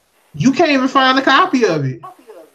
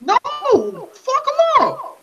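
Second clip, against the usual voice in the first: high-pitched, incredulous comeback; indignant retort; 13 semitones higher, much slower than usual. Acted. Answering viewers who pity baby goblins, indignant retort. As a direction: stretch it out in mock outrage.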